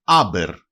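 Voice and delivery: slow, careful άμπερ with open a; teacher demo of the word άμπερ; example word. Shown as a demonstration. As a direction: spell out άμπερ slowly, syllable by syllable. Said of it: "Παράδειγμα".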